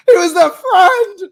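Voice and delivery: anguished, drawn-out yell, high and loud; mock a crying boy screaming in betrayal; imitated scream. Podcaster acting out Harry learning of the betrayal, screaming. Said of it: "starts screaming".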